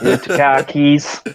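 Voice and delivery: put-on slow, drawn-out Boston accent. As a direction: stretch it out like a Bostonian. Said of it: "How do you do Boston again?".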